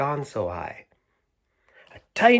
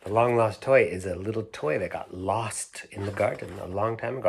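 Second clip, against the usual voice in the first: ordinary delivery, nothing marked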